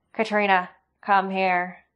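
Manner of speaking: loud, firm order; command. Acted. Sloane commanding his daughter to come to him, loud. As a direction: order her to your side. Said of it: "Sloane commanded".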